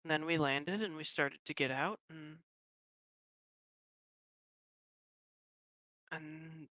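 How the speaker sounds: very soft, halting recollection; hushed, uncertain. Acted. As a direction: trail down to almost a whisper. Elf recounts losing missing companions.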